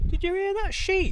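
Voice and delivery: can't believe the sheep answered; amazed. Real, not acted.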